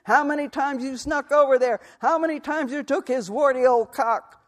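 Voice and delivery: high, loud, raging tirade; furious shouting. Acted. Husband screaming accusations at his wife in the street, furious. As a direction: hurl every line at her like a blow.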